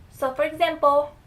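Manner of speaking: ordinary delivery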